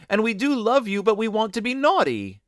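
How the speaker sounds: put-on high, insistent children's plea; crying out. A narrator's reading. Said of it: "they cried".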